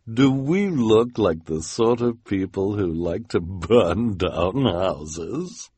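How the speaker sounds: slow, drawn-out mock-innocent question; falsely innocent villainess. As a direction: play innocent, stretching the words. Esme feigns innocence.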